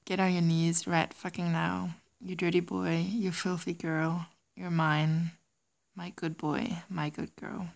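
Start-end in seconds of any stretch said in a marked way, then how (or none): none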